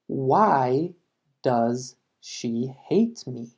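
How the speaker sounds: very slow, with hate carried by a falling tune; very slow classroom model, falling on hate; slow teaching read. Shown as a demonstration. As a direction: drag it out word by word. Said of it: "Otro ejemplo".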